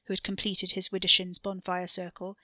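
usual speaking voice

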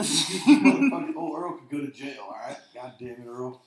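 laughing through the words, loud; cracking up while saying it; real laughter breaking the words. Real, not acted. Laughing over old Earl.

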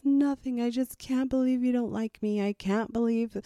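high pleading whine, imitating a tearful child; mock whiny daughter. Mother imitating her daughter's tearful complaint, high and whiny. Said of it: "And she says".

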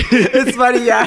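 high, loud laughing agreement. Real, not acted. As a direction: laugh out the words.

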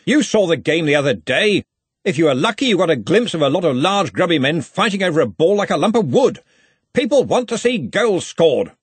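loud, high-pitched lecturing; blustering senior wizard. A narrator's reading. Ridcully explains to Ponder what crowds want, blustering. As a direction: hold forth with emphatic bluster.

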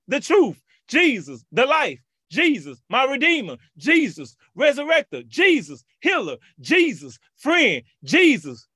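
high, loud preaching litany, each title called out; fiery preacher chant; preaching at full force. Acted. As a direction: call each name of Jesus out to the church. Call-and-response name litany.

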